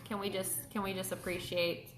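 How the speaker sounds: normal talking voice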